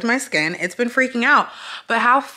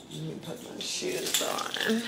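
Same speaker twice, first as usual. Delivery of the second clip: whispering; 3 semitones lower, 11 dB quieter, slower than usual. Real, not acted. Quiet aside while leaving.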